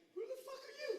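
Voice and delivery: very high-pitched mock alarm; shocked squeal. Alcoholic to bedmate the next morning, shocked squeal. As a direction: squeak it in morning-after horror.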